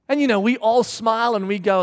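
ordinary talk, nothing marked

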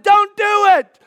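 high, loud plea; desperate shout. Acted. Believer imagining himself before the crowd, shouting desperately. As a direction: shout to stop them. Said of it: "I would have shouted".

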